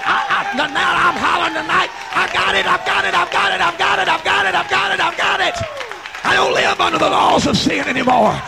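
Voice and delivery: full-force preaching. Real, not acted. Preacher living under the laws of Jesus, jubilant shout. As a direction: build to a roaring, stretched-out declaration.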